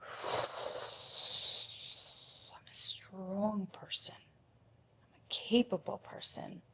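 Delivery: slow, soft unvoiced whisper to herself; self-encouraging whisper. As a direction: coax yourself gently, in a whisper. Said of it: "And then I'm like".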